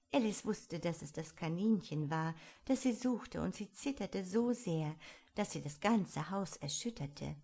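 plain reading voice

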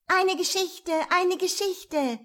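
put-on high, eager children's chant; children calling. A narrator's reading. Children begging the little fat man, high eager calling. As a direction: beg like excited children. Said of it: "riefen die Kinder".

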